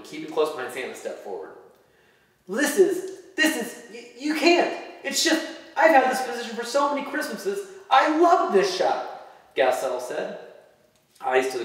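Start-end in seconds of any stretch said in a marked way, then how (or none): 2.5-9.3 much higher, pleading little elf; upset elf. Acted. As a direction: stammer out a hurt protest.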